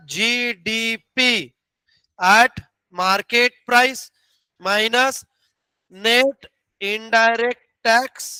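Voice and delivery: very slow, word by word dictation; dictating formula. Shown as a demonstration. Teacher dictating the GDP formula to students, very slow. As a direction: drag each term out so the class can copy it. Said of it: "फिर यहां का formula क्या था?".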